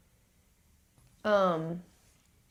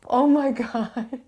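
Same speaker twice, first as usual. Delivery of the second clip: slow, louder stunned exclamation; shocked drawn-out oh my god; 4 semitones higher, 6 dB louder, much slower than usual. Real, not acted. Reacting to the spirit box, stunned and drawn out. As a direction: stretch it out in disbelief.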